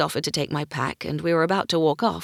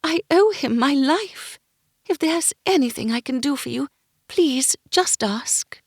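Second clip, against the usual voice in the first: gentle lady voice; 9 semitones higher, 4 dB louder than usual. Acted. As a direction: speak like a kind court lady. Miranda offers help.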